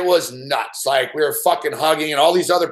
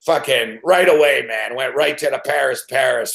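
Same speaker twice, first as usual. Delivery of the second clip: ordinary talk, nothing marked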